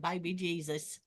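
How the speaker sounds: put-on "baby" bent toward "bye-bee" in a country accent; hushed imitation of a rural accent on "baby Jesus". Shown as a demonstration. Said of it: "people get that accent when they say that".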